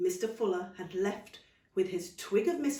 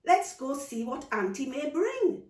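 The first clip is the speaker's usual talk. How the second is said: put-on eager child; 7 semitones higher, 6 dB louder than usual.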